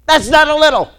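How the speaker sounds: high, very loud indignant protest; indignant outburst. Retold or imitated. Patient protesting the doctor's weight target, indignant yell. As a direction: yell it back in mock outrage. Retold outraged response to his doctor.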